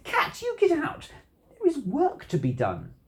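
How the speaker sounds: high-pitched angry order, starting loudest; indignant storybook telling-off; mad. A narrator's reading. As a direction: scold the cat and order it out. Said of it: "And then I got mad".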